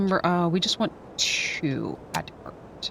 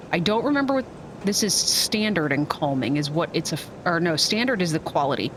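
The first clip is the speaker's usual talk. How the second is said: ordinary talk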